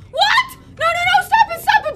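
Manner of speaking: high, incredulous pleading to stop; real high-pitched dismay; alarmed protest. Real, not acted. Attacked while taking photos.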